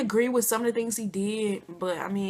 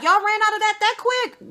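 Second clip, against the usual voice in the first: high, loud mock of the drunk man; his drunk voice; 12 semitones higher, 6 dB louder than usual. Older man asking if the cash ran out, voiced high and loud. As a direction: play the tipsy older man teasing the girls. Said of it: "He'd be like".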